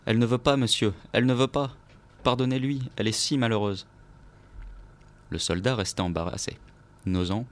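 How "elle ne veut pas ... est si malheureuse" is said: higher, louder pleading cry; the old maid begging the officer for her mistress. A narrator's reading. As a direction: beg him desperately on your knees.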